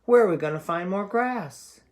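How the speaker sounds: fretful goat voice; nervous middle goat. Acted. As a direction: squeak it out like a nervous little goat. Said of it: "asked the middle brother".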